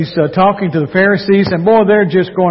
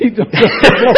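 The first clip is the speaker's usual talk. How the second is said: laughing; 5 semitones higher, 4 dB louder, faster than usual. Real, not acted. Teasing amid heavy laughter.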